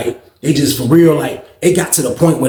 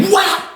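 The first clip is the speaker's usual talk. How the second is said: sharp high whop; imitated punch sound. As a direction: smack the sound out.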